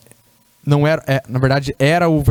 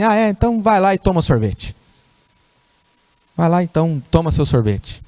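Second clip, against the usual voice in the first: ironic command; 4 semitones higher than usual. Retold or imitated. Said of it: "Aí eu perco a paciência".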